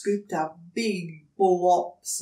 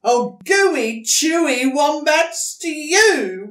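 higher, loud, slow proud boast; 8 semitones higher, 11 dB louder, slower than usual. Acted. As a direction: show off your stew. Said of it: "boasted Dingo".

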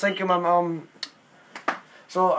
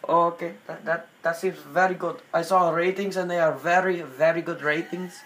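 put-on hesitant Indian accent, full of fillers; 6 semitones lower, 3 dB louder than usual. Prank caller claiming homesickness for India, in Indian accent. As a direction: fumble politely in an Indian accent.

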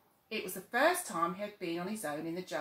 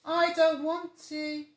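put-on higher, slow drowsy child; sleepy little tiger; 9 semitones higher, 9 dB louder, slower than usual. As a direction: droop into sleep. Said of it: "said Little Tiger sleepily".

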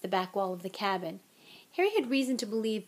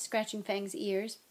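usual speaking voice

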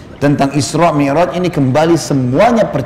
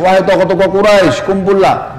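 summoning call; 5 semitones higher than usual. Retold or imitated. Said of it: "panggil orang-orang Quraisy".

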